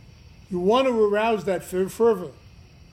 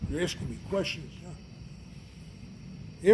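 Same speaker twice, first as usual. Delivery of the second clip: ordinary delivery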